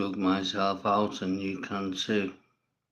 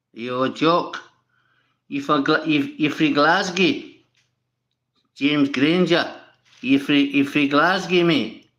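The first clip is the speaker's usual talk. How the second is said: put-on loud, higher jeering Glasgow accent; mock Glaswegian accent; 6 semitones higher, 8 dB louder than usual. Mocking a Glasgow viewer.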